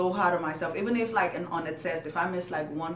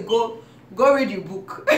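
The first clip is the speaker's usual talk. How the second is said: louder, laughing mock mom order; mom's snapping order; 4 semitones higher, 7 dB louder than usual. Kid tells mom she's bored, mock mom snaps back laughing. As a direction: play the strict mom, laughing through it.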